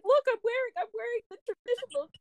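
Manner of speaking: high-pitched eager show-off; acted-out giddy pleading; mock excited. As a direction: imitate an over-keen son, voice way up. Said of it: "really excited".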